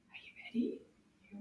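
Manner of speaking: hushed whisper, building suspense; whispered question. Acted. Teacher whispers to kids before the fast round. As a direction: whisper it like a secret.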